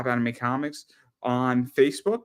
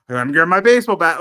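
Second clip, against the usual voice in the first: mock tough declaration; 5 semitones higher, 9 dB louder than usual. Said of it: "you're like".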